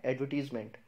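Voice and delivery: "एडवर्टीजमेंट" as ad-vuh-TEEZ-ment; no R sound, "teez" in "एडवर्टीजमेंट"; correct pronunciation. Shown as a demonstration. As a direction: model "एडवर्टीजमेंट" with long "ee". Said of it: "इसको क्या बोलते हैं?".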